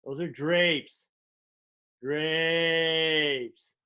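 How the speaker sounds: very slow, spaced-out voice; slow stoned drawl. Retold or imitated. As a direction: stare and say it slowly, totally baked. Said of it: "you point and go".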